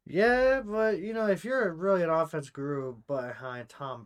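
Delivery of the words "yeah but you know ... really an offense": excited yeah. Real, not acted. Jumping in to argue.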